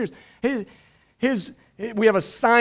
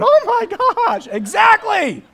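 much higher, loud gushing agreement with a laugh; congregant's reaction; 7 dB louder than usual. Retold or imitated. Imitating a congregant agreeing about the dog, squealing. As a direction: squeal like an eager listener who agrees. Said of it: "somebody over here just went".

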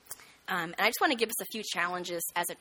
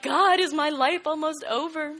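worried prayer; 8 semitones higher, 5 dB louder than usual. Retold or imitated. Frightened prayer in hospital bed.

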